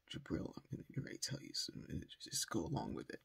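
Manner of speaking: low, hushed, hurried aside; conspiratorial aside. Retold or imitated. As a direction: quietly let him in on the plan. Secret aside to son on phone.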